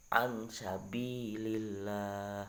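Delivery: Ansabilillah very slow with a light lam; slow Quran demo, thin lam; thin Allah. Shown as a demonstration. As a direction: recite it with tarqiq. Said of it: "dibaca tipis".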